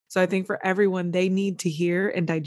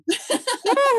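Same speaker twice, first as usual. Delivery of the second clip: high bursting laugh of amazement; delighted laugh; 3 dB louder than usual. Real, not acted. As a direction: let out a real high laugh. Host reacts to moving story.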